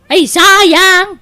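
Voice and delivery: what a waste, said out loud in dismay; dismayed exclamation. Acted. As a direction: react as if the loss just hit. Hears the shirts were lost.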